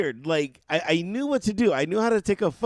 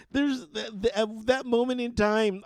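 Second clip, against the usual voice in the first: ordinary delivery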